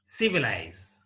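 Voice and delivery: "Civilize" stressed on CI, then falling away; first-syllable stress. Shown as a demonstration. Teacher demonstrating stress, first syllable of "Civilize" stressed. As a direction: hit CI in "Civilize" and let the rest drop. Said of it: "on the first syllable".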